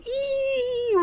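high gleeful yelp; excited squeal. Real, not acted. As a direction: squeal with glee. Cheering the final giveaway.

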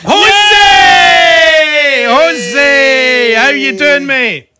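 loud, high, drawn-out hail; calling out greeting. Real, not acted. Host greeting a caller on the line, loud hail.

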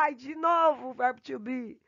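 lightly hammed-up classroom grumble; imitated student complaint.

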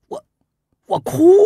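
stunned disbelief. Acted. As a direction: caught off guard by the odd advice. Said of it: "大怔".

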